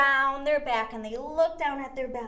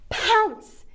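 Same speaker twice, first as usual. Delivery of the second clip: excited pounce; 8 semitones higher, 5 dB louder than usual. Acted. Kids' yoga game, pouncing on mouse.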